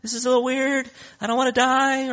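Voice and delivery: high-pitched, comic frightened people; hammed-up worry, pitched far above normal; mock scared. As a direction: play the timid crowd in a high little voice. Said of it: "And then they're really scared".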